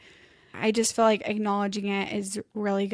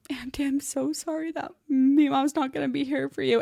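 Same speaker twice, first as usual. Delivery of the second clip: put-on higher, tearful quote of a young niece; retold tearful apology, pitched up; 5 semitones higher than usual. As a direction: gently imitate a girl close to crying. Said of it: "we both are just bawling".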